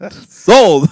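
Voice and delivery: loud, high sarcastic laugh-shout. Pretend. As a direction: fake enthusiasm, laughing. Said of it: "He's being very sarcastic".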